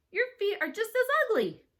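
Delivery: put-on high, loud childish comeback; shouted retort. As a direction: fling the insult back at full pitch. Girl hurls insult back in quarrel.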